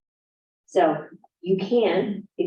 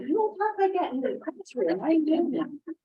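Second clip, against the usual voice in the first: higher, mock disbelieving rebuke; incredulous scolding; 10 semitones higher, 3 dB quieter than usual. Teacher mock-scolding a student's fake video voice, incredulous. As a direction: pitch it up like you can't believe them.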